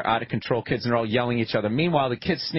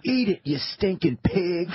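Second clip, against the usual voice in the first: bad movie line; 3 semitones higher than usual. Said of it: "stops and looks at the cop and goes".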